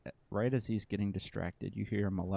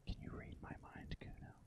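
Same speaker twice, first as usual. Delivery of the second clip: breathy whisper, almost no voice; hushed uncanny whisper; 16 dB quieter than usual. Acted. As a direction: whisper it like a secret. Whispered question to the boy.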